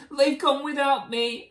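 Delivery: high, loud wail; hammed-up lament; crying out. A narrator's reading. Drip left behind by family, high mock wail. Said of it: "And he cried".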